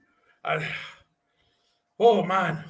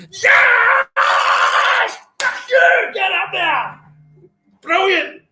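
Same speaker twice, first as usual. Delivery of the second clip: very high, loud victory screams; real, uninhibited jubilation; ecstatic shouting; 14 semitones higher, 7 dB louder, much slower than usual. Real, not acted. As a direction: roar in wild celebration. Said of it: "my wife's telling me to shut up".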